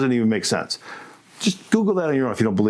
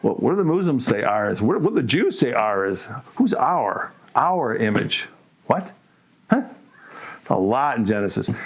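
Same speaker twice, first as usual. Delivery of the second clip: ordinary delivery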